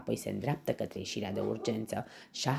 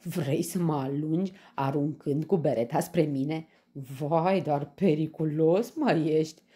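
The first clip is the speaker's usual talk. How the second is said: louder, higher mock alarm; mocking lion; 4 semitones higher, 6 dB louder than usual. A narrator's reading. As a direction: tease him as if he were dangerous. Laughing lion mocks the little hero. (leu batjocoritor)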